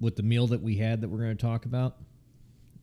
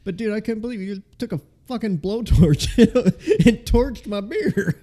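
laughing, high and loud; cracking up mid-sentence; 9 semitones higher, 8 dB louder than usual. Real, not acted. Laughing at blowtorched beer.

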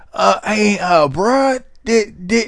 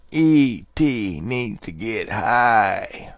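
slow, much lower alien voice, dragged out; 10 semitones lower, 8 dB quieter, much slower than usual. Retold or imitated. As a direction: drawl it slowly like a stoned E T. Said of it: "imagine E T saying".

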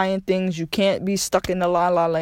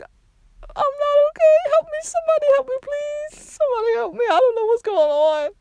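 mock terrified inner cry, near sobbing; inner panic cry. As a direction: beg for help in a thin, tearful, very high voice. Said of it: "but inside I'm like".

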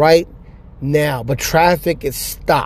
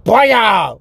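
mock shotgun blast; 6 semitones higher than usual. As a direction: fire the imagined shotgun with your voice.

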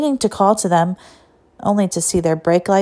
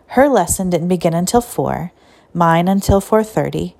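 ordinary delivery

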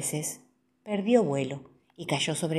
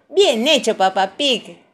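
high, loud praise; bright wifely well-done, raised voice; congratulating; 5 semitones higher, 8 dB louder than usual. A narrator's reading. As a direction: cheer him for a job well done. Mamá Pig praising her husband.

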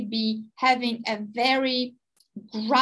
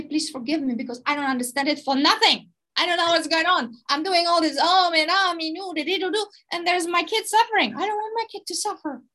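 flustered anxious parent; 5 semitones higher than usual. Pretend. Voicing a panicking parent.

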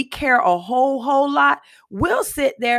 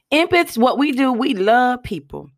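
ordinary talk

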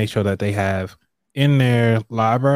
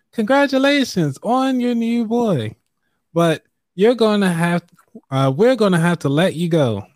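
high female voice, read aloud; bright higher voice for the boss; woman voice; 8 semitones higher than usual. Acted. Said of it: "Let me do my woman voice".

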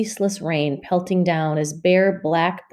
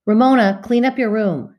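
ordering; 5 semitones higher, 6 dB louder than usual. Acted. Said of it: "she ordered".

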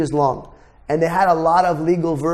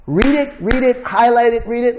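raised, punchy commands to read; emphatic urging; 5 semitones higher, 3 dB louder than usual. Real, not acted. Coach urging students to study, louder and higher. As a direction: drill it into the students.

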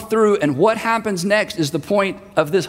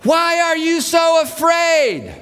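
grinning shout; 9 semitones higher, slower than usual. Retold or imitated. Jesus teasing panicked disciples over the storm, grinning shout. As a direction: shout it with amusement. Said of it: "I think he's grinning".

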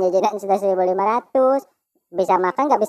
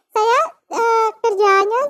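squeaky high cartoon chipmunk; 12 semitones higher, 4 dB louder, slower than usual. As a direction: pitch way up like a chipmunk. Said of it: "pengisi suara chipmunk".

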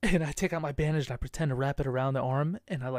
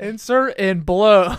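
slow punchline; 5 semitones higher, 11 dB louder, much slower than usual. Real, not acted. Punchline said slowly.

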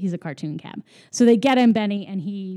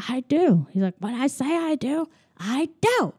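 mock insistent; 5 semitones higher than usual. Roger insisting in cartoon argument.

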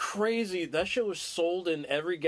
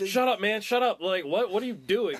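higher, louder frantic shut up; mock freaked-out yell; 5 semitones higher, 3 dB louder than usual. Friend imitating Spanky panicking at his friends, yelling. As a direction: snap at everyone like you're tripping.

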